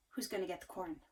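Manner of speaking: ordinary delivery, nothing marked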